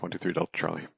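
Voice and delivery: ordinary talk, nothing marked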